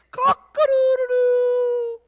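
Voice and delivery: very high, loud, long-held crowing; rooster crow. Acted. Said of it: "the rooster crowed".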